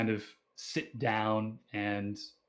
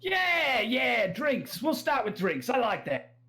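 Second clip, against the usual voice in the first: high, delighted Australian cheer; rowdy Australian drinker seizing on the idea; Australian accent; 12 semitones higher than usual. Acted. Drunk local welcomes the offer of drinks, excited Australian accent. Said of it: "that's my Australian accent".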